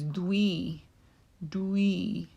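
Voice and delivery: "dui" drawn out as one syllable; teacher sounding out "dui"; separate syllable. Shown as a demonstration. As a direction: say "dui" slowly, set apart, then repeat it. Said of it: "we have to say it separately".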